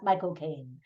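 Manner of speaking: low, soft British "my-cocaine"; British accent. Shown as a demonstration. Reviewer demonstrating how to say the actor's name, British accent. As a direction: run "my" and "cocaine" together, British-style. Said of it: "say it correctly with a British accent".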